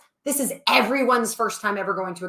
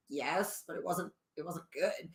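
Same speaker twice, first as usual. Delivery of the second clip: low and much softer, hesitant; reluctant quiet admission; 5 semitones lower, 13 dB quieter than usual. Retold or imitated. Telling the person in charge, softly and reluctantly. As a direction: admit it softly, sheepish. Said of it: "I was like".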